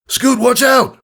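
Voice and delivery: high, loud yell of alarm; urgent warning shout. Acted. Father yelling to Scootaloo as lightning strikes, high urgent shout. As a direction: shout the warning over the storm.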